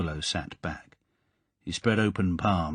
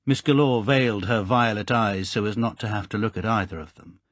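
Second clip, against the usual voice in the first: plain reading voice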